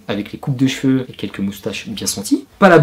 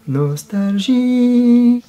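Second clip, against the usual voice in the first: mock nostalgic sigh; 12 semitones higher, much slower than usual. Wistful one-word aside.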